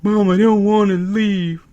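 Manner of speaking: mock whiny teen; whiny Eli. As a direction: whine to mom like a kid. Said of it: "And he's like".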